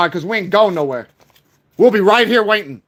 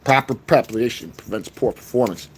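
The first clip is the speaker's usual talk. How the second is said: much lower and softer, ominous motto; deep voice; 11 semitones lower, 6 dB quieter than usual. Acted. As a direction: drop the voice low and quiet.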